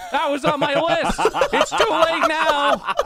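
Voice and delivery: high, loud and slow, laughing through it; mock retort dissolving into laughs; sarcastic laughing reply; laughing sarcasm.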